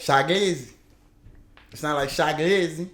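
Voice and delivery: Shaggy said as Shaq Lizzy, DC style; DC accent. Shown as a demonstration. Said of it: "That's my D C accent".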